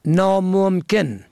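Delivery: normal talking voice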